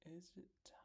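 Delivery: very soft, teasing whisper; breath-only hushed question. Real, not acted. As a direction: whisper it as if checking secretly.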